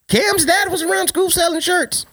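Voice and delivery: high, put-on little boy's reply; mock child voice; kid voice. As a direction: answer like a caught kid. Kid answers parent in skit.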